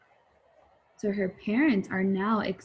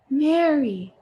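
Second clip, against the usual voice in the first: mom calling; 6 semitones higher, 6 dB louder than usual. Acted. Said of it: "said Mom".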